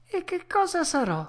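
put-on high puppet-boy question; worried child dreading the answer; anxious Pinocchio. A narrator's reading. Pinocchio asking what he will become, high and anxious. Puppet fears his fate.

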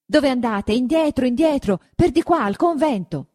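high, loud frightened orders; a boy still shaken, turning them back; urgent frightened redirect; trembling urgency. A narrator's reading. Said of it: "ancor tutto tremante".

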